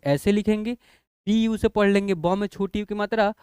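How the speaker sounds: usual speaking voice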